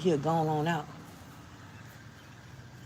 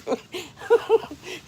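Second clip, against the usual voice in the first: high laughing fit; 4 dB louder than usual. Real, not acted. Laughs at the bug.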